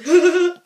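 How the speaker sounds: high loud crazy laugh; imitating a maniac giggle; mock cackle. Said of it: "then goes".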